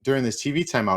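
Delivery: ordinary talk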